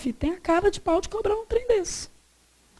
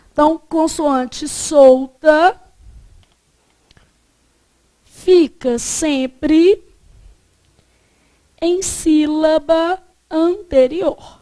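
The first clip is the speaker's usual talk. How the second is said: dictated slowly, louder, word by word; slow dictation; 5 dB louder, much slower than usual. Shown as a demonstration. Teacher dictates the rule for the class notes, slow and deliberate. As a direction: spell the rule out slowly so students can write it down. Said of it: "Então, coloca aí pra mim, você vai colocar assim".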